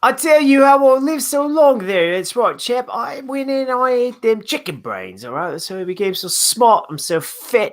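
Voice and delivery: broad put-on Australian accent, pitched high. Co-host playing the long-lived rancher, wobbly Australian accent. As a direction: slip from Australian toward British accent. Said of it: "your Australian accent quickly went British".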